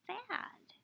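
ordinary talk

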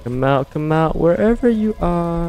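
high teasing sing-song call; sing-song taunting. Acted. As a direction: taunt it out like a playground chant. Luring the monster out.

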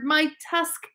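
put-on young walrus whimpering that his tusk hurts; whiny little walrus. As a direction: whine it like a hurt child. Wilbur complains again.

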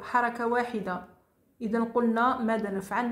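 ordinary delivery

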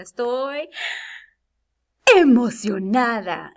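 loud, drawn-out burst of excitement; excited exclamation. Shown as a demonstration. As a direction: hold back, then burst out excited. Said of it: "para decirlo bien, ponemos los puntos de exclamación". (exclamación muy emocionada)

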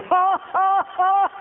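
laughing at the house in faith; mock laughing. Preacher laughing at an unsold house from his car. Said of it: "Learn to release your faith in laughter".

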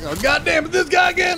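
loud, very high-pitched comic yell; play-acted angry shout; mock exasperated outburst. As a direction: shout it like a hammed-up fed-up casting agent. Acting out a casting reaction.

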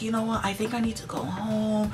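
high, soft little voice; mimicked frail, hushed request; imitated coworker. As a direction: play the poorly girl asking meekly to leave. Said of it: "She was like".

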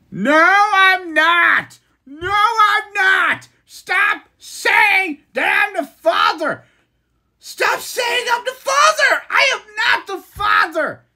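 slow, high desperate shouting with a crack of crying at the end; hammed-up "I'm not the father" outburst; shouted denial. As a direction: yell each denial, dragging it out, voice breaking on the last. Mock talk-show paternity denial.

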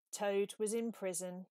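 plain reading voice

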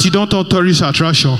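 normal talking voice

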